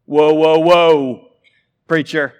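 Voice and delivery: loud startled objection from a pew; hammed-up voice of a shocked listener; mock protest. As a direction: call out to stop the preacher, loud. Congregation member protesting the preacher.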